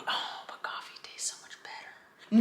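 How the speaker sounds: whispered, wheedling inner voice; whispered temptation. Pretend. Vlogger's craving voice whispering for coffee, tempting whisper.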